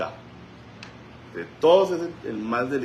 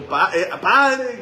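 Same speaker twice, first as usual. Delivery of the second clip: high, loud, drawn-out cry of Padre; loud cry to God; 8 semitones higher, 7 dB louder, much slower than usual. Retold or imitated. Pastor cries out to God over the woman, loud and high. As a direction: cry out to the Father with full force. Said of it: "grito yo fuerte".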